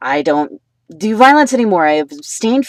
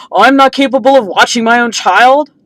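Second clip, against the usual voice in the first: higher, louder mock outraged partner; indignant retort; 6 semitones higher, 6 dB louder than usual. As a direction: throw it back as an offended question.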